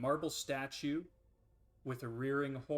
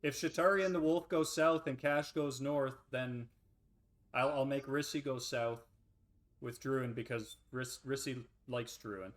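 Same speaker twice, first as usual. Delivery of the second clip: usual speaking voice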